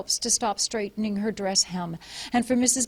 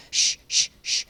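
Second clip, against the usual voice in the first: soothing shush. A narrator's reading. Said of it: "Mildred said".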